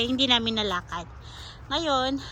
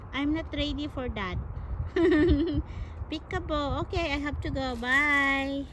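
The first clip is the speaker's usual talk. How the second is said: cooing to a squirrel; 2 semitones higher than usual. Real, not acted. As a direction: sweet-talk a shy little animal. (malambing na pagsuyo sa ardilya)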